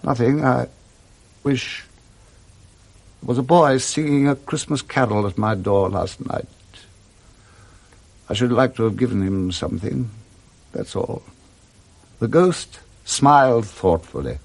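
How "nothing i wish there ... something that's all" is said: fading to a whisper, holding back tears; choked evasion. Acted. Scrooge to the ghost, hiding his tears, softly fading. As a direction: swallow the tears, let it die away.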